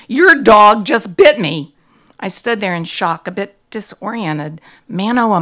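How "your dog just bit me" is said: loud, slow, emphatic complaint; indignant exclamation. A narrator's reading. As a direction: exclaim it loudly, word by word. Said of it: "I exclaimed".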